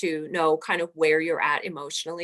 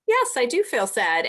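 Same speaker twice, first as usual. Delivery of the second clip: higher and louder, a playful, overdone yes; emphatic admission; 7 semitones higher, 5 dB louder than usual. Retold or imitated. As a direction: own it boldly, half joking. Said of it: "I was like".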